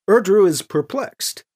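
ordinary delivery, nothing marked

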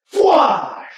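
high, airy whooshing gunshot noise; imitated blaster shot. A narrator's reading. Rifle fires at the guardsman, a high imitated blast. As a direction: voice a sudden energy-bolt blast.

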